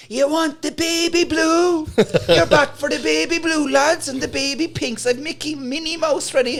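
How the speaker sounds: high, loud market-stall cry; brother shouting. Retold or imitated. Brother hawking ShamWows at the crowd, loud high cry. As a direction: bellow it like a stall trader hawking to passing bikies. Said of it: "my brother started shouting".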